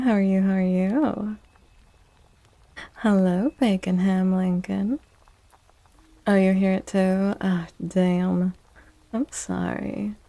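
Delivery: ordinary talk, nothing marked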